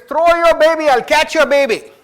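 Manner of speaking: high, loud call up to the window; calling up to her. Retold or imitated. Man in the crowd calls up to the mother at the burning window, high loud call. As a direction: shout it up to her: throw it, I'll catch it.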